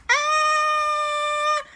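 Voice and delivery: squealing high scream; high yelp. Acted. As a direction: shriek as you land. Characters yelp landing in tree.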